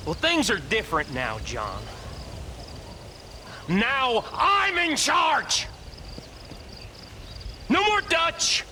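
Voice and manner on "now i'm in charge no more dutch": defiant shout. Acted. Outlaw defiantly claims leadership.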